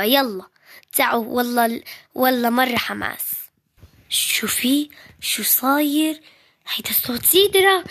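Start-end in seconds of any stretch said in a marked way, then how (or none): 4.1-7.8 excited whisper. Acted. Excited secret about visitor.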